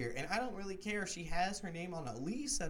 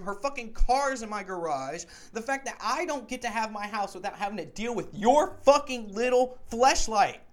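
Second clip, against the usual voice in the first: raised, heated shouting at the roommate; angry retold rant; 6 semitones higher, 7 dB louder than usual. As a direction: fire back every grievance, a bit louder and higher.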